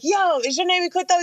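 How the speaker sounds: high-pitched call, at talking volume; retold call-out, pitched well up; calling across street. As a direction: imitate hailing a stranger over the road.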